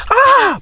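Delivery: high mock scream; very high-pitched "ah!"; imitated scream. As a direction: imitate a startled crowd shrieking. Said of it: "se escuchaba a la gente".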